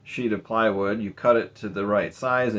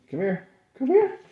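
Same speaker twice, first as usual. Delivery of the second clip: much higher sing-song dog call. Real, not acted. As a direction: coax the dog over in a high voice. Calling the dog Max.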